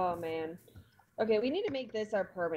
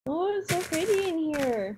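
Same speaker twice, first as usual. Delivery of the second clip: awed delight; 9 semitones higher than usual. Real, not acted. Awed by pretty cave.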